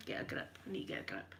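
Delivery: unvoiced whisper to herself, pulling it together; whispered self-talk. Real, not acted. Steadying herself on stream so she won't cry, whispered. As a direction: whisper a pep talk under your breath. Telling herself to stop crying.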